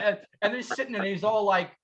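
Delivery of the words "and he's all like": ordinary delivery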